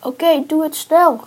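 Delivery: loud, high shout; urgent call. A narrator's reading. Trapped friends to the man fetching help, calling urgently. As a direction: call out, hurrying the helper along. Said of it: "riepen Men en".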